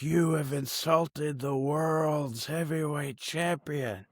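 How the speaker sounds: strained mock scream, held back in volume; Harley Race. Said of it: "And he's screaming".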